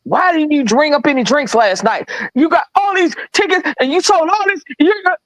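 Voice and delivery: high, put-on voice of the scolding boss; mimicking the angry boss. Retelling the manager scolding her over drinks, mock high boss voice. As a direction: ham up the little manager chewing her out. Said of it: "He said".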